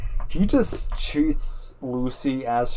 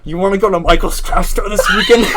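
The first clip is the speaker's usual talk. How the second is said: high, loud parody laughing through; fake-casual invite, barely holding a laugh; mocking parody; 9 semitones higher, 8 dB louder than usual. Teasing Alan with a fake invite, high loud parody.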